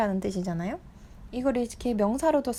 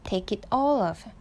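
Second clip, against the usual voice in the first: all punched in a slow read of Take it all off; teacher showing where the stress falls; much slower than usual. Shown as a demonstration. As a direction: hit the word all, slowly. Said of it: "강세 여기 있는 거".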